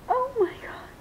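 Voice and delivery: quick high squeal of delight; amazed squeal. Real, not acted. Touches the doll's hair.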